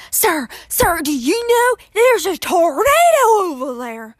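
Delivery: loud, high, desperate plea; urgent shout. Retold or imitated. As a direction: shout to warn him, begging. Begs old man about tornado.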